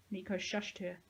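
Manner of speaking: ordinary delivery, nothing marked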